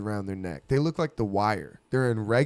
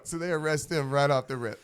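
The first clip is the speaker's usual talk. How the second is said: normal talking voice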